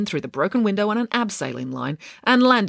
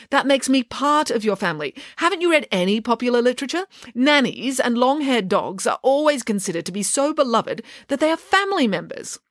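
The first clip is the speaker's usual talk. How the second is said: nanny arguing her case, pitched higher; a touch of self-important insistence; lightly voiced haughty nanny; 7 semitones higher than usual. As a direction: lecture with mild indignation. Figure argues in story.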